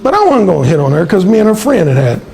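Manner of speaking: ordinary talk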